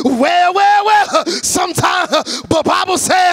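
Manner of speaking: high, loud half-sung preaching with gasps; hammed-up revival preacher, shouted and chanted; old-school preacher whoop. Shown as a demonstration. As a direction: hit them with full hooping preacher cadence. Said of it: "I'll hit you with the".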